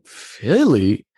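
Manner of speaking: high-pitched, incredulous retold reaction; baffled disbelief. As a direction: squeak it out, totally baffled by the idea. Retelling his baffled reaction to wife's suggestion.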